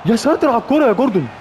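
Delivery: high, loud cry of disbelief at the shot; excited yell. Real, not acted. Player groans at his striker, excited loud yell. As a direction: shout it like the ball just flew past.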